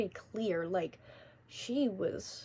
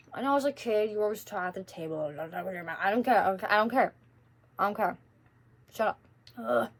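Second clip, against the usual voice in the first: mouth full; 3 dB louder, faster than usual. Real, not acted. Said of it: "I'm gonna talk with my mouth full".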